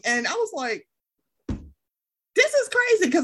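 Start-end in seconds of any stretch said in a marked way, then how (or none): 2.3-3.1 high-pitched, incredulous reaction; retold disbelief. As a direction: retell your disbelief, pitch jumping up. Retells her shocked reaction.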